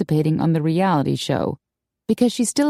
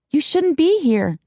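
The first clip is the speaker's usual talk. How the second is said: higher-pitched mild protest; insistent; 9 semitones higher than usual. A narrator's reading. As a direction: insist that they leave. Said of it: "Darcy insisted".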